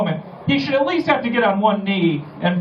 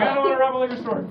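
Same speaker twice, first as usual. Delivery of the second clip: higher, fast, panicky refusal; alarmed refusal; 7 semitones higher, faster than usual. Retold or imitated. Person offered crack, refusing in a quick high panic. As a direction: blurt it out as a quick horrified no. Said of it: "And you're like".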